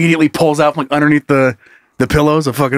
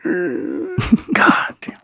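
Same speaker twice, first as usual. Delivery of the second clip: 1 annoyed ghost groan; 2 semitones higher, 5 dB quieter, much slower than usual. Acted. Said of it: "it's like".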